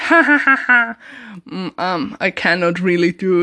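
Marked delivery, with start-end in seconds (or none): 0.1-1.0 high, loud mock creepy laugh; badly faked creepy cackle, pitched high. Imitating the homeless character for viewers, creepy laugh. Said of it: "just this creepy laugh, like".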